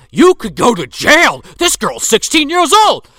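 high, loud hammed-up indignation; mock outraged shout. Retelling a man warning someone about jail, mock outraged shout. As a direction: yell it high as comic outrage.

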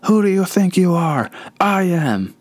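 high, excited bowler yelling at the crowd; imitating a thrilled bowler at the title win; Pete Weber. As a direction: ham up a pumped-up champion's boast. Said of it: "He goes".

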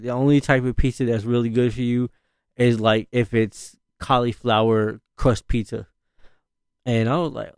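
usual speaking voice

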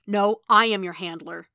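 loud, forceful correction with I stressed; hard, commanding rebuke; stern contradiction. A narrator's reading. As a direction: assert authority angrily. Stick angrily contradicts Matt.

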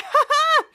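high, gleeful "ha ha"; triumphant laugh. Retold or imitated. Schwartz celebrating, gleeful laugh. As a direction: crow with delight.

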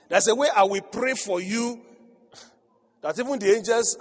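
rising preacher's cry; preaching. Acted. Preaching building.

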